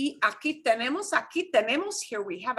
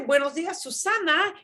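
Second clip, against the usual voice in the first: lively greeting; 6 semitones higher than usual. Shown as a demonstration. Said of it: "When we say".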